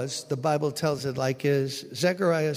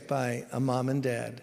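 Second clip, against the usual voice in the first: ordinary delivery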